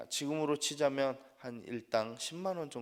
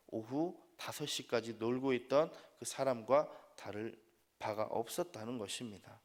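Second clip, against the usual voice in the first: ordinary talk, nothing marked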